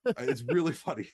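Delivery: ordinary delivery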